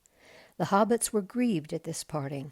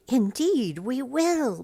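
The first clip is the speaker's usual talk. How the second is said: higher, louder eager hobbit reply; cheerful hobbits; 8 semitones higher, 8 dB louder, slower than usual. A narrator's reading. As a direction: answer brightly like young hobbits. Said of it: "they said".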